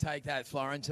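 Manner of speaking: ordinary delivery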